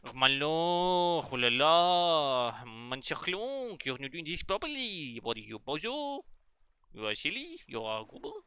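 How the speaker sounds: drawn-out, sing-song mock French accent; hammed-up French accent, teasing insults; imitated French accent. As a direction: play a silly French scolder, vowels stretched high. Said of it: "They keep saying things funnily".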